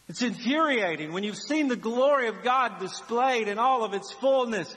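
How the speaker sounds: ordinary talk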